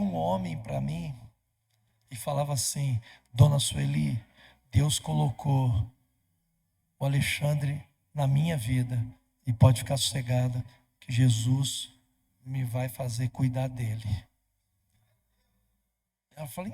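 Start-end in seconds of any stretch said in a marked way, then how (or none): 3.3-14.2 loud, emphatic preacher voice; preaching. Acted. Dream messenger assuring Dona Sueli, preached loud.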